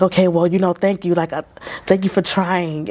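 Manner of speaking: ordinary talk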